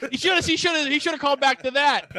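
talking through real laughter, high and loud; laughing. Real, not acted. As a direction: crack up while getting the words out. Cracking up at co-host's joke.

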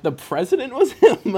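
high, loud, disbelieving laugh; laughing disbelief. Retold or imitated. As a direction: laugh out the shock of finding out. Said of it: "I'm like".

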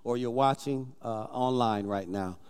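low, quiet altar call. Acted. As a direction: call them gently to their feet. Pastor calls congregation to stand.

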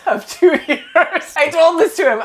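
breaking into a laugh mid-phrase. Real, not acted. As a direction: laugh through the words. Laughing mid retold apology.

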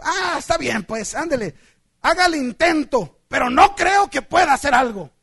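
loud, doubtful go-ahead; mildly scornful consent; grudging mechanic. Acted. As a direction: give in while sure it will fail. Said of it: "Y entonces el mecánico dijo".

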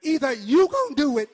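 loud, rhythmic pulpit delivery; punchy shouted sermon cadence; full-force preaching. Acted. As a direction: hammer each phrase home to the congregation.

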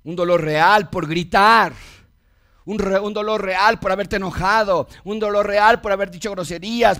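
emphatic, repeated pulpit refrain; forceful preaching. Real, not acted. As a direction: hammer each repeated phrase home.

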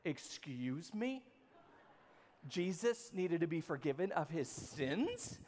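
quietly stunned, as if you misread it; incredulous. Retold or imitated. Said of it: "and I went".

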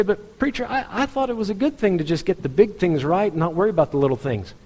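high-pitched, puzzled objector voice; protesting churchgoer. Acted.

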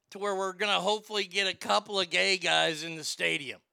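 normal talking voice

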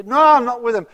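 loud heated denial; angry denial. Acted. Peter denies knowing Jesus to accusers, loud furious denial. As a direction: shout the denial, cornered and furious.

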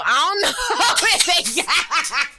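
high, slowed by laughter; laughing while talking. Real, not acted. Trying to explain herself to co-host, breaking into laughter. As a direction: crack up mid-sentence.

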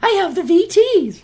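very high, squealing fangirl impression; hammed-up falsetto swoon over an accent; swooning American woman. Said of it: "But to American".

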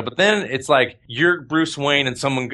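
ordinary delivery

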